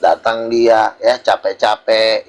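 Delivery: normal talking voice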